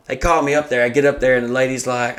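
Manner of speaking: usual speaking voice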